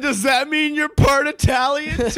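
very high, drawn-out mocking question; mock incredulous quote. Teasing a friend with their own question, high mocking voice.

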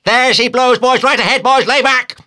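high, loud whale cry building; climbing to a full high yell; excited shouting. A narrator's reading. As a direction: urge the rowers on at a shout. Boat officer urging crew at whale.

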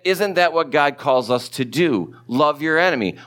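usual speaking voice

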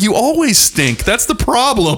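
high-pitched, drawn-out comic exasperation; exasperated. Retold or imitated. Husband answers wife's stink question, mock exasperated. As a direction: push it up high as a fed-up retort.

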